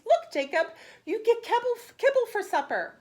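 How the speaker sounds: high, loud teasing offer of kibble; father calling the dog over, high and loud; playful announcement. Acted. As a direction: dangle the treat like a fun surprise.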